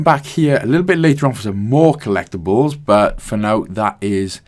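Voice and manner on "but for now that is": normal talking voice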